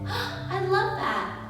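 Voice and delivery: high slow gasp and laugh; delighted gasp. Acted. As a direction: gasp with delight then praise. Teacher delighted.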